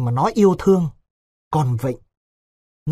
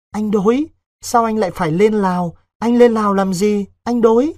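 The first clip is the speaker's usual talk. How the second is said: put-on high, louder pleading girl's voice; a frightened girl begging her lover to stay; pleading young girl; 6 semitones higher, 4 dB louder than usual. A narrator's reading. Vịnh begging Đối not to go to Laos, high and pleading.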